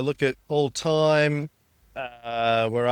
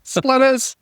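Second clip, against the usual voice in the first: laughing, much higher pitch; mock film line; 12 semitones higher than usual. Quoting own film line, laughing.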